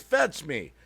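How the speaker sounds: normal talking voice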